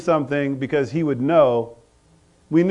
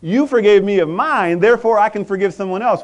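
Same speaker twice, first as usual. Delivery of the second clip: ordinary delivery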